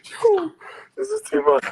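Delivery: losing it laughing at the joke. Real, not acted. Cracking up at Danny's jokes.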